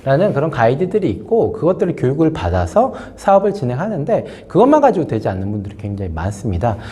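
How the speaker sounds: ordinary delivery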